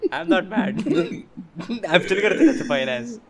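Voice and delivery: high laughing put-on line. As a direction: laugh through the quoted line. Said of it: "I'm like".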